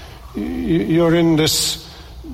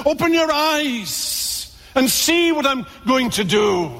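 high, slow proclaiming shout; preached shout; 12 semitones higher, slower than usual. Acted. Preacher voicing God's call to his blind children, shouting. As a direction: cry it out as God's summons.

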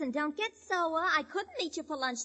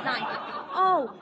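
ordinary talk

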